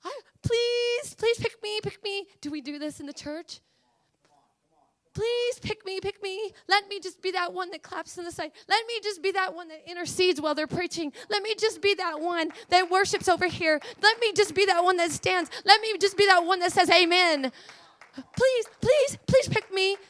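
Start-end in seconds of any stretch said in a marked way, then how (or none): 0.0-2.3 high, loud pleading plea; tearful begging. Retold or imitated. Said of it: "and I'm crying". 5.1-20.0 high, loud, pleading cry; tearful begging. Retold or imitated. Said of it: "and I'm crying".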